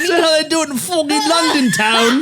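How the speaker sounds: high fake Cockney-ish voice; laughing parody British accent; mock British accent. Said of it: "make fun of her accent".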